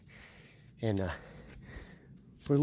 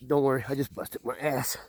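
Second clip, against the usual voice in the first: ordinary talk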